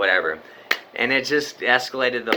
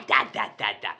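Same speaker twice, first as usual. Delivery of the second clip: ordinary talk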